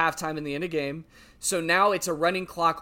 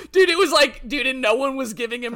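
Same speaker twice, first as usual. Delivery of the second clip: laughing; 9 semitones higher, 7 dB louder than usual. Real, not acted. Cracking up at the clip.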